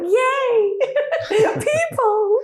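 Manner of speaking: high, delighted squeal of joy; thrilled cheer at visitors; excited yay. Retold or imitated. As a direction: squeal it like you finally see people. Excited greeting from balcony.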